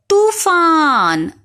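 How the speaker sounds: तूफान with the long ऊ drawn out slowly; long vowel stressed. Shown as a demonstration. Teacher making students hear the long ऊ in तूफान, drawn out. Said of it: "देखिए आपको बड़े ऊ की मात्रा सुनाई दे रही है".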